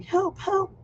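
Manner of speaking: high-pitched imitated cry for help; mock kid's scream. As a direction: squeal it high as a kid heard through the ceiling. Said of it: "I hear a kid screaming".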